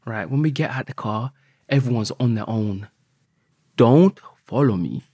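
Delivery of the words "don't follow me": slower and louder, leaning on don't; firm order. Retold or imitated. Telling the guys not to follow him, firm and slow. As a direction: lay down the law, slow and firm.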